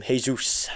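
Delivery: Jesus said as Hey-sus, Spanish style; Serrano's Jesus. Retold or imitated. Fan quoting Serrano's Jesus as Hey-sus, quietly. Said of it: "as Serrano called him".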